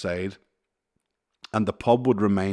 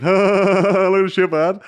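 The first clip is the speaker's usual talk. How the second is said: high, slow, jeering crowd voice; drawn-out high mock jeer; laughing at the fallen man; mocking bar crowd; 10 semitones higher, 12 dB louder, much slower than usual. Bar crowd mocking his fall.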